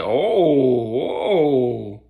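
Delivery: long, loud falling "oh" of a crowd; class reacting. Classmates reacting to a comeback at the bully, drawn-out oohs. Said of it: "the whole class is like".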